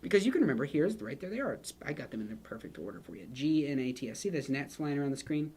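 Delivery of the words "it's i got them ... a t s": normal talking voice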